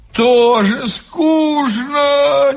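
high, drawn-out half-sung wail; bored, sing-song lament. A narrator's reading. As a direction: howl it slowly, between singing and crying. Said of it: "и опять взвыла".

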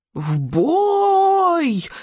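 high, long-drawn war cry; happy battle yell. A narrator's reading. Dragon charges the deer, happy high-pitched yell. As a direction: yell it gleefully, stretching the vowel. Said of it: "счастливо заорал Беззубик".

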